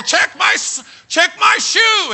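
loud, high demanding yell; exasperated shout. Retold or imitated. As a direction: demand it at the doctor. Demanding his lost toe.

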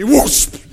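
loud, high whoosh; whoosh of fire. Acted. Preacher imitating fire from heaven, explosive whoosh. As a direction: imitate a sudden blast of flame.

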